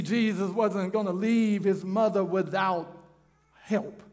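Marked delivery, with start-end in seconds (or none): none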